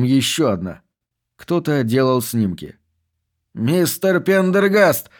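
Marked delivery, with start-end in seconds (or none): 3.5-5.1 drawn-out call across the rubble. A narrator's reading. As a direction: call his name after him, slowly. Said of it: "позвал Кастер".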